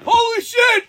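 very high, loud mock shriek; imitating a friend's horrified outburst; shocked scream. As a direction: scream at what you walk in on. Imitates friend discovering the scene.

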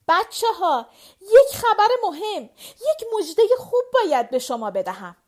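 excited announcement. A narrator's reading. Mother bursting in to tell the children the big news. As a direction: call out the good news, laughing and near tears.

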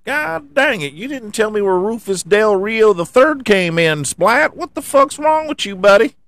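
squeaky high whiny complainer voice; mock angry fan. Mocking a fan emailing about his driver, high whiny rant. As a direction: mimic a ranting fan way up high. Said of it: "emails saying".

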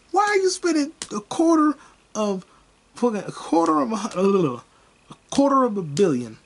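high-pitched disbelief at the figure; incredulous outburst. Real, not acted. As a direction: stumble over it in loud, high amazement. Incredulous rant about the movie budget.

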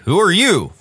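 restrained story-reader's shout; mild mock scream. A narrator's reading. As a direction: call out the question in storytelling style. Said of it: "I screamed".

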